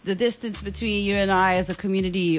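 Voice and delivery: usual speaking voice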